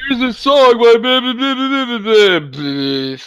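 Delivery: chirpy mock announcer, then slow blahs; mocking everybody. Friend mimicking people pushing a song, high mock cheer. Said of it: "everybody's always like".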